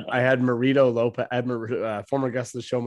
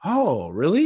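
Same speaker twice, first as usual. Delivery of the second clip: higher, pleasantly surprised cop imitation; disarmed cop; 4 semitones higher, 4 dB louder, slower than usual. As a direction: act out a cop suddenly won over. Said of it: "they disarm right away".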